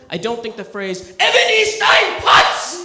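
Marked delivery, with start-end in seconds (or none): 1.2-2.8 loud, high, slow fake German bark; hammed-up German outburst at the dinner table; mock German shout. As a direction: yell it like angry German parents. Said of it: "speaking German".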